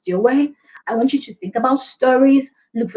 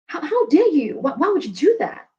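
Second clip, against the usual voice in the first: higher-pitched, playful affront; mock indignation; 6 semitones higher than usual. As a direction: act offended at the criticism. Acting indignant at being called aggressive.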